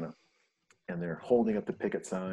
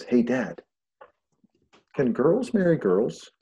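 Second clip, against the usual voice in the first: lightly voiced daughter, higher and tentative; daughter's question; 4 semitones higher, 6 dB louder, slower than usual. Daughter asking her dad a question, lightly imitated. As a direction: ask slowly, pitched up like a kid. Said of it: "my daughter comes home".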